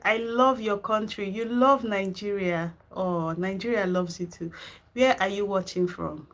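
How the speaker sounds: ordinary talk, nothing marked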